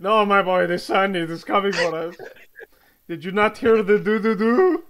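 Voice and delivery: loud, frantic warning; very loud panicked doctor; alarmed. Acted. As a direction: warn them urgently at full voice. Doctor warns the sun is coming.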